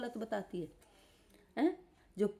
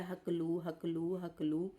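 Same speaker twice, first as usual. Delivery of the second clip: mock stammer taunt; 4 semitones lower, 5 dB quieter, slower than usual. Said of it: "बीमारी का मजाक उड़ा के बोलती है".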